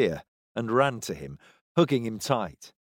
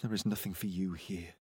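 cold; 4 semitones lower, 12 dB quieter than usual. A narrator's reading. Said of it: "stared coldly at Silas".

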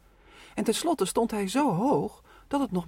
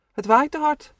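raised, higher worried call over the wind; mother fretting loudly about the wind; 8 semitones higher, 8 dB louder than usual. Acted. Mother warns on the windy beach, raised worried voice.

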